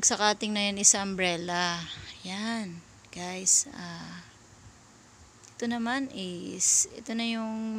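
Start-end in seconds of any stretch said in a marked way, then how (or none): none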